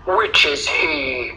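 very slow lion voice; drawn-out question from a big cat. A narrator's reading. Lion asking the girl about the bully, slow lion voice.

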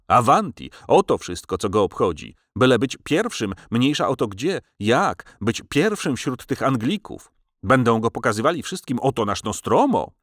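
ordinary delivery

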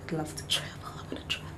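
whispered wish, barely voiced. Real, not acted. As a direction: breathe the wish like a secret prayer.

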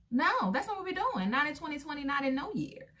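high, emphatic no to the idea; firm refusal. Real, not acted. Telling viewers she won't accept rough men, emphatic.

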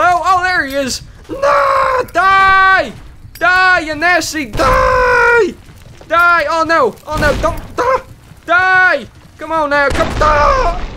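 high, loud frantic yells; furious battle yells; frantic shouting. Real, not acted. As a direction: scream at the enemy as you fire. Fighting hidden shooter.

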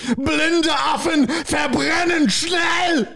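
high, drawn-out German shout; mock German screaming. Host shouting a quiz phrase, slow mock German scream. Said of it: "you're just screaming".

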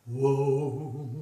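long, low "wow"; chest voice. Shown as a demonstration. Coach showing chest voice, low held sound. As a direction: let it resonate low in the chest. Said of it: "la voix de poitrine".

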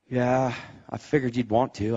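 low, subdued imitation of a patient; resigned sick man. Stepdad in hospital bed agrees to prayer, low and resigned. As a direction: drop it low and soft, giving in. Bedridden stepdad's reply, voiced.

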